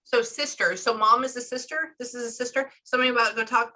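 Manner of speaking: ordinary talk, nothing marked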